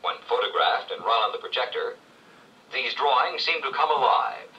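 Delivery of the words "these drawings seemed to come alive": ordinary talk, nothing marked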